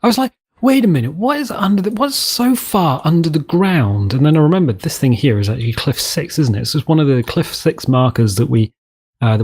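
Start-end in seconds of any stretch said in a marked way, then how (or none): 0.6-4.1 higher-pitched puzzled double take; mock bewilderment. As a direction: act out a baffled realisation, pitched up. Retold puzzled reaction to discovery.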